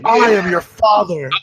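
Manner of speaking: slow, loud, grandly drawn-out declaration; Darth Vader. Retold or imitated. Mocking the lover as the father, booming villain parody. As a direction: proclaim it like a hammed-up movie villain. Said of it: "target a Dark Vader".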